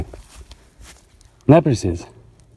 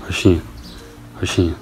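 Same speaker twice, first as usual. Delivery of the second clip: coaxing the cow; 3 semitones higher, 6 dB quieter than usual. Real, not acted. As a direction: murmur her name gently to calm her.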